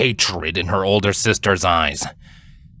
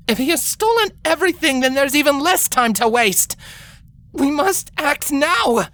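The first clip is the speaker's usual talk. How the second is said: a lightly voiced, higher determined younger sister; stubborn young princess. A narrator's reading. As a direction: insist on going, jaw set. Twilight urges acting now.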